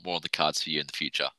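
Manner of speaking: ordinary delivery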